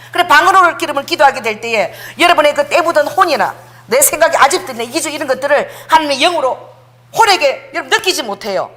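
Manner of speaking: usual speaking voice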